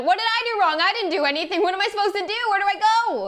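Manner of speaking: high, frantic pleading questions; Lady Macduff hearing killers are coming; panicked. Acted. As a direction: beg for answers in rising panic. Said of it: "Lady Macduff freaks out".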